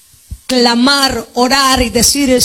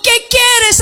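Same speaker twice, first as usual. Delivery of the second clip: preached shout; 10 semitones higher than usual. Acted. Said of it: "Te está preguntando".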